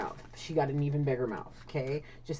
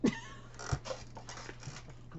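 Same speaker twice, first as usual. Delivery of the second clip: breathy laugh; 8 dB quieter than usual. Real, not acted.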